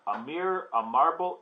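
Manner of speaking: usual speaking voice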